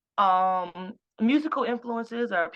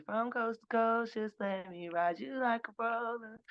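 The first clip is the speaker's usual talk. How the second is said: slow, soft, seductive take on the lyric, dropped softer; slow and steady; 3 semitones higher, 6 dB quieter, slower than usual. Shown as a demonstration. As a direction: ease the line out slowly, like a tease. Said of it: "slow and steady".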